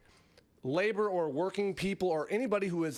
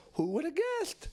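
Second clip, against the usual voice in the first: Who would have guessed in a much higher put-on Putin voice; mock Putin; 9 semitones higher than usual. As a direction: shrug it off like a clueless Putin. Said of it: "this is him".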